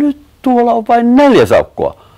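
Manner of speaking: very high, loud cry; alarmed shout. A narrator's reading. Sanni sees an otter is missing, alarmed shout. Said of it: "Sanni huudatti".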